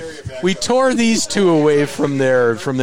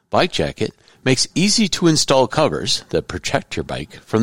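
steady, even podcast ad read, a bit lower; sponsor ad read; 5 semitones lower than usual. Acted. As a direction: read the sponsor spot like a host ad. Host reads sponsor ad.

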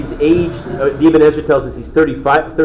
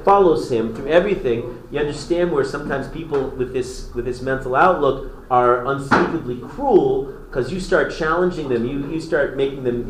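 ordinary talk

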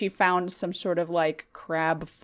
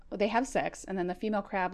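normal talking voice

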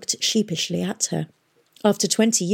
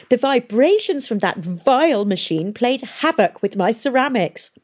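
high, swooping complaint; distressed old scholar; 6 semitones higher, 4 dB louder than usual. Acted. Historian grumbles about his damaged ceramics, distressed. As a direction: fuss over a precious collection.

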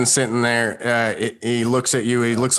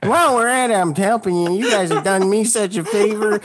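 a lightly raised pitch for the grateful cowboy; playing the thankful sidekick, pitched up; NPC cowboy voice; 7 semitones higher than usual.

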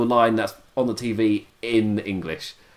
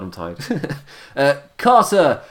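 ordinary talk

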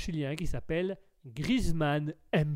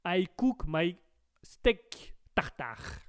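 comically bad French-accented English; halting English with slow French accent; Louis de Funès; much slower than usual. Streamer mimics a comedian's English, slow French accent. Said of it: "tu as appris l'anglais avec de finesse".

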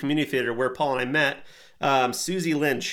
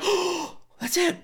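epiphany; slower than usual. Retold or imitated. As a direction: gasp out a sudden realization. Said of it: "And I was like".

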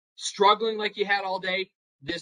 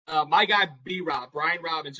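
ordinary talk

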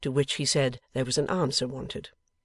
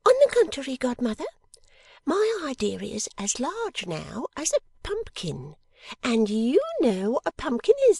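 put-on high-pitched girlish character voice, curious; sharp little dressmaker, very high voice; eager, quick-witted little dressmaker; 10 semitones higher, 4 dB louder than usual. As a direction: pipe up high, drawing out the words. Plays Miss Wren in story.

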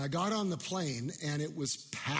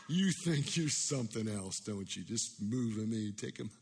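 put-on gruff drunk man; 3 semitones lower than usual. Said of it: "gruff, loud voice".

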